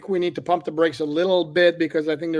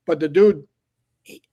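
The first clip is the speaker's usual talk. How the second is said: usual speaking voice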